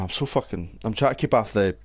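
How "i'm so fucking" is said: ordinary delivery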